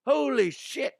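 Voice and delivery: very high-pitched mock amazement; astonished exclamation. Shown as a demonstration. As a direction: squeal it like a stunned commentator. Demonstrates an excited exclamation.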